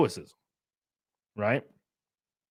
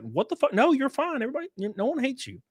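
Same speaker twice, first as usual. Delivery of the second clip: much higher, baffled mock reassurance; incredulous reassurance; 12 semitones higher, 3 dB quieter than usual. Friends telling the panicker nobody hates them, high incredulous. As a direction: play the baffled friends brushing it off.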